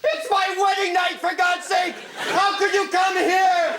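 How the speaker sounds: shouting in hammy outrage; angry shout. Acted. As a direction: yell it at the intruder. Confronting the ex-lover.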